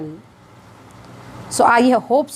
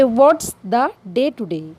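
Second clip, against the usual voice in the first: slow teaching demo; much slower than usual. Shown as a demonstration. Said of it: "इसे कहेंगे".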